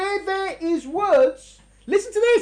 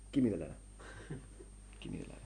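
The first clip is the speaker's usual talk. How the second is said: quiet demand; 18 dB quieter, much faster than usual. Retold or imitated. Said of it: "One of the supporters of Trotsky said".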